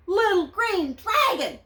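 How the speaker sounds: princess calling. Acted. Princess calling to the dragon, high and loud. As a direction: call out to him like a worried princess. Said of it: "said the princess".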